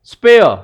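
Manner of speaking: "spare" loud and high, vowel from the throat; throaty spare. Shown as a demonstration. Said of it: "coming from the throat again". ("spare" गले से ज़ोर से)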